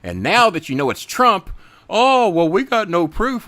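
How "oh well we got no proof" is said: high whiny mock voice of the defenders; mocking Republican excuse. Mocking Trump defenders in a high whiny voice. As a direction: sneer it like a caught hypocrite.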